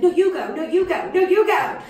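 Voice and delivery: high, loud insisting voices, mock-arguing; mock squabbling. Girlfriends all deferring to each other as openers, high mock squabble. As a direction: act out friends loudly insisting over each other. Girlfriends fighting over the opening slot.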